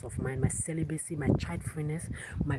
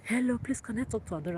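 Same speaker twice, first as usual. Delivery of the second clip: higher-pitched imitation of a caller; worried caller; 7 semitones higher, faster than usual. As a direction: lightly act out an anxious phone caller. Voicing worried callers on phone.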